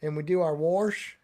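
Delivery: "wash" said as "warsh"; Saint Louis pronunciation. Shown as a demonstration. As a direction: put an r into "wash". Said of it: "we do that in Saint Louis".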